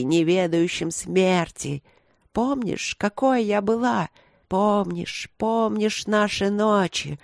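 slow, drawn-out, wistful crone; old priestess, insinuating. Acted.

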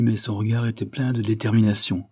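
usual speaking voice

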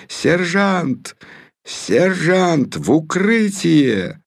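high desperate calling out; shouting orders over battle. A narrator's reading. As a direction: call across the battlefield. Calls sergeant during bombing. (отчаянный зов сквозь взрывы)

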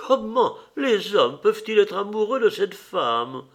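high, astonished exclamation; indignant old lady, high voice; exclaiming old lady. A narrator's reading. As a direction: cry out as the old hostess, pitched well up. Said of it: "s'écria la vieille dame".

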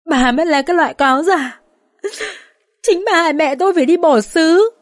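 high-pitched tearful accusation; sobbing. A narrator's reading. Dương holds her face and accuses mother-in-law, sobbing. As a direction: hurl the accusation through acted sobs, pitch climbing. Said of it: "Con Dương vừa ôm mặt vừa nức nở nói".

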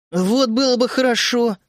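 радостный возглас, повыше; воскликнуть с надеждой; восклицая. A narrator's reading. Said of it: "воскликнул я". (радостно воскликнуть)

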